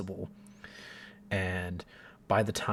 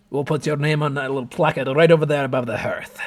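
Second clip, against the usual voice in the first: cheerful dwarf; 6 semitones higher, 10 dB louder, faster than usual. Acted. Dwarf points to placard.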